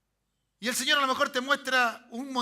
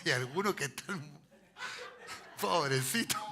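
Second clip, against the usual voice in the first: talking through a real laugh, lower; amused; 7 semitones lower than usual. Real, not acted. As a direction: chuckle while you pity them. Laughs at his own example.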